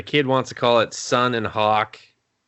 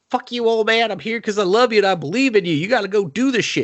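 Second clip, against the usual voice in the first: high, raised-voice aggressive encouragement; reverse pep talk; 9 semitones higher, faster than usual. Retold or imitated. Michael to a doubting old friend, gruff-love pep talk. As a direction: fire him up in a high, insistent voice. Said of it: "gives him a reverse pep talk".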